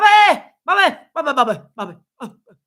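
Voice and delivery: high, loud mock yell of [name]; mock [name] yelling. Vlogger mocking [name] calling for [name], high mock yell. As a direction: ham up a frantic call for [name].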